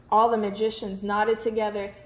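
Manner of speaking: ordinary delivery, nothing marked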